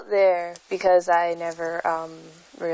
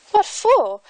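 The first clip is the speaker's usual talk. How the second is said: surprised question; 11 semitones higher, 3 dB louder than usual. Acted. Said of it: "said the one who first had spoken".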